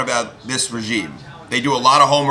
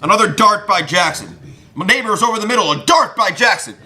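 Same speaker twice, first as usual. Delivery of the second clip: play-by-play call; 7 semitones higher than usual. Retold or imitated. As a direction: call the pass like a radio announcer. Mock radio call of the pass.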